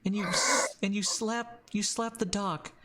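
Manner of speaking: high, halting, earnest voice; Danny. Acted. Danny pleading for Voldo's trust, high and earnest. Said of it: "she goes".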